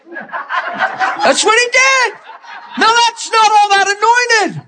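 very high, drawn-out laughing voice; helpless high-pitched laughing delivery. Real, not acted. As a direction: squeal it out through laughter. Laughing with audience at his story.

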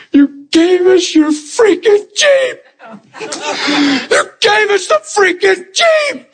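high, slow bawling wail; imitated intense crying. Recovering man thanks giver for the Jeep, imitated sobbing. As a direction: bawl it out word by word, imitating a man undone. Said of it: "crying as intense as a man could cry".

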